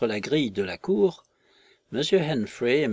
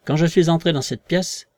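louder, raised voice on the threat; invisible man's threat; 5 semitones higher, 6 dB louder than usual. Acted. Invisible man threatens the visitors with the poker, loud and firm.

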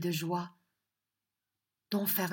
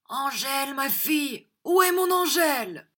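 high, loud panicked shout for his daughter; anguished cry; 7 semitones higher, 7 dB louder than usual. A narrator's reading. The prince bursting in for his daughter, anguished shout. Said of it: "s'écriant avec angoisse".

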